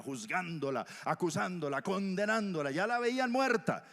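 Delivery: building outrage. A narrator's reading. Crowd rage building against adulteress.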